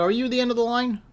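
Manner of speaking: high, lilting polite question. Retold or imitated. Asking an old lady politely.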